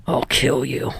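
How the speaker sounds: low, gruff threat; low growl. A narrator's reading. Katsuki threatens Hanta.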